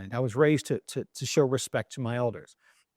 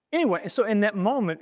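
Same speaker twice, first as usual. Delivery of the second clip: ordinary talk, nothing marked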